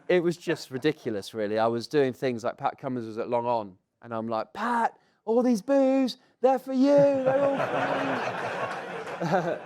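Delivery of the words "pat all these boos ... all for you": high, slow call across the field; taunting call. Retold or imitated. Batter winding up Pat at long on, taunting shout. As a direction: imitate a drawn-out goading shout.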